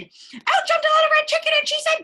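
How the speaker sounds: high, excited little chicken bursting in; bright high interrupting chick; squeaky chicken voice. Acted. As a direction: pop in high and eager.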